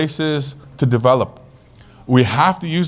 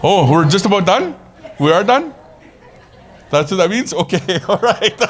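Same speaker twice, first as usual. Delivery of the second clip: surprised; 5 semitones higher than usual. Real, not acted. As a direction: voice jumps up high on learning time's up. Told the time is up.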